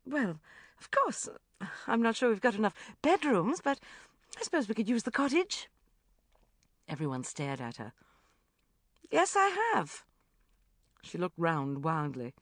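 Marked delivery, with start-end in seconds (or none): 0.0-5.7 flustered backpedalling, loud and high. A narrator's reading. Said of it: "Rebecca looked flustered". 9.1-10.0 defiant. A narrator's reading. Jude defiant.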